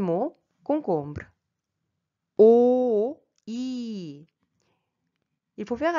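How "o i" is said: "O, I." as two long vowels, first one louder; closed-mouth vowel. Shown as a demonstration. Teacher demonstrates cucumber in Korean, closed-mouth vowel. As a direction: hold "O" with a well-closed mouth, then "I". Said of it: "bien fermer, euh, votre bouche".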